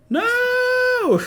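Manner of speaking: very high, loud cry of despair; shrieking no over the lifeless robot; anguished scream. Acted. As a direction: scream it in denial. Finds Ren won't open his eyes.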